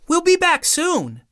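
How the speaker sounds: put-on high, quick child's voice; a boy rushing out the door; eager young boy. A narrator's reading. Douglas dashing out.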